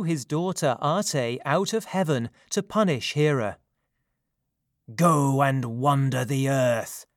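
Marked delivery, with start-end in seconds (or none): 4.9-7.1 slow, booming pronouncement; thunderous command. A narrator's reading. Zeus banishes his daughter from heaven, in a thunderous voice. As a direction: command like an angry god, drawn out. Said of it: "he shouted in a voice of thunder".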